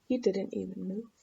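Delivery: ordinary delivery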